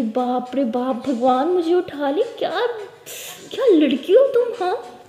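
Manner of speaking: drawn-out loud exclamation of disbelief; exasperated. Acted. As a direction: throw up your hands in mock despair. Ishani exasperated by Pari's logic.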